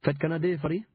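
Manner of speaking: weary Highland Scots; Scots accent. A narrator's reading. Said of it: "he said tiredly".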